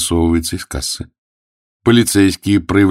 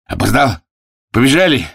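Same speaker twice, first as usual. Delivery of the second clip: mildly raised, excited cry; agitated; 7 semitones higher, 4 dB louder than usual. Acted. Sweaty latecomer bursts in at the races, agitated. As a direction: lift the voice in a fluster. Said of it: "взволнованная фигура".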